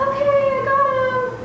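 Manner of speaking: mom pretending. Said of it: "she was like".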